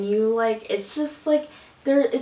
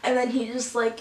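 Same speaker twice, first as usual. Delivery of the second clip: normal talking voice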